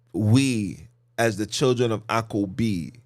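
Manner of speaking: normal talking voice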